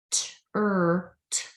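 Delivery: "t, er, t" as separate speech sounds; sounded-out phonemes. Shown as a demonstration. Showing where lips go, "t, er, t" sounded out singly. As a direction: isolate each sound in "t, er, t", pauses between. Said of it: "And when you say".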